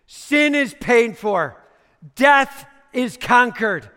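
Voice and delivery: high, loud and slow declaration; triumphant proclamation. Acted. Preacher voicing Jesus at the cross, proclaimed loudly. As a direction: announce victory with full force. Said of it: "he was saying".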